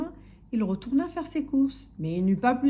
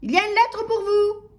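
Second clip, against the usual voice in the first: shouting postman; 11 semitones higher, 9 dB louder than usual. A narrator's reading. Postman greeting sleepy householder, shouting. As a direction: holler a greeting at the door. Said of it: "cria le facteur".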